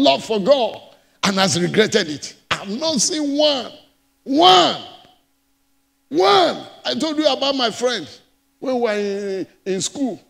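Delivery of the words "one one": "One." called out higher and louder; emphatic one. Acted. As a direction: punch "One." like a challenge. Preacher insists not one.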